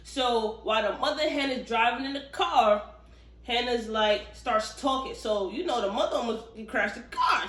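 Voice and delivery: usual speaking voice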